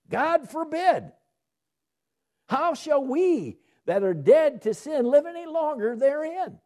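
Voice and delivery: "God forbid" thrown up high in shocked outrage; outraged Paul. Acted. Preacher voicing Paul's outrage at wicked thinking, high and appalled. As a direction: cry it out as if appalled at the thought. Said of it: "It's like a spirit of outrage".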